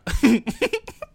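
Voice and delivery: high loud burst of laughter. Real, not acted. Laughing at his own 4K brag, high and loud. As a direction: crack up at your own joke.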